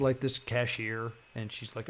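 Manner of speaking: usual speaking voice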